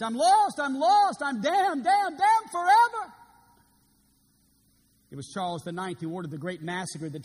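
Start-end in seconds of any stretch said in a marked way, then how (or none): 0.0-3.1 high, loud anguished wail; desperate cry. Acted. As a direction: cry out in terror of damnation. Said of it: "cried out these last final words".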